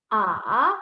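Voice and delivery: AH dipping low, then rising back up; falling-rising AH; third tone. Shown as a demonstration. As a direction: let AH sink down and swing up. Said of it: "let's read the tones once more".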